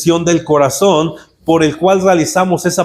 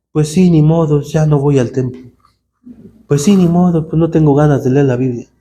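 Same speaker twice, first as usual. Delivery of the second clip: lower, resigned and careless; resigned shrug; 3 semitones lower than usual. Retold or imitated. Believer giving up on church, resigned and lower. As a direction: shrug it off, voice sunk low.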